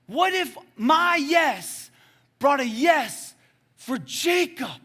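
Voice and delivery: high, slow, loud re-enacted questions; impassioned shout. Friend asking the preacher what if, impassioned shouting. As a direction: cry out each question, stretching the key words.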